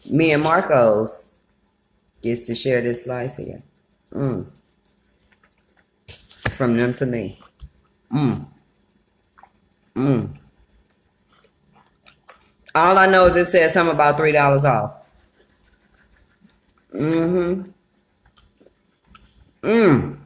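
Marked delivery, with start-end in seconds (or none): 2.5-10.4 chewing while talking; talking between bites; mouth full of pizza. Real, not acted. Seen 1: bites pizza at -4 to -2, then chews through labelled words. 12.7-20.2 talking while chewing pizza; words muffled by food; mouth full. Real, not acted. Seen 2: She bit the pizza at -9 s and still seems to be chewing.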